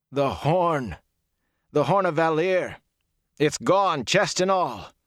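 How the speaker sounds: high, emphatic exclamations; anguished outburst. A narrator's reading. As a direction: break the terrible news in disbelief. Ingtar anguished over the stolen horn.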